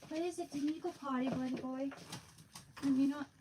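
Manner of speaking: high, soft pet talk. Real, not acted. Mom baby-talking her dog.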